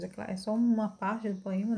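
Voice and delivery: usual speaking voice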